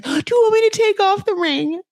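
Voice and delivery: high, hurt mock plea, a touch of imitation; imitating a distraught wife's high question; upset plea. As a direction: lightly play the wounded wife asking. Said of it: "like, so upset".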